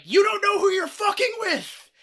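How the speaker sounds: high, loud battle yell; screaming. Retold or imitated. Man re-enacting his rant at invading flies, full-on screaming. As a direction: bellow it like a raging Viking. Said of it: "I just start screaming at them".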